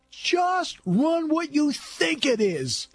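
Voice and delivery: high, drawn-out mock holler of a football call; stretched-out imitation yell; imitated yell. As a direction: holler it slow and high, imitating an exasperated play-caller. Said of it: "he'd yell out".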